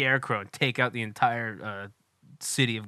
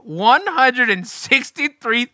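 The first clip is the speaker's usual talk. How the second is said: building excitement; 8 semitones higher, 8 dB louder, slower than usual. Real, not acted. Dramatic reveal of balloon count.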